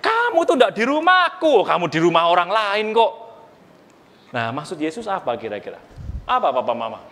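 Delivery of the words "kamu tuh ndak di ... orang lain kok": higher, offended dad protesting; mock-hurt father correcting his son; playful indignant Yusuf. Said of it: "Lose bilang".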